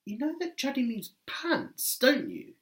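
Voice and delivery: a mild mock-innocent challenge; pointed question. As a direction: needle the manager knowingly. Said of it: "I said".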